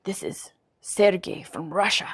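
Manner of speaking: mock Russian spy introduction; deliberate Russian-accented voice. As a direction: imitate a mysterious Russian caller. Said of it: "this thick, like, Russian accent".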